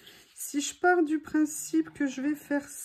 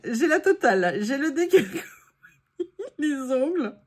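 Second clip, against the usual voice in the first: laughing dismay; 5 dB louder, faster than usual. Real, not acted. Laughing, glue on nails.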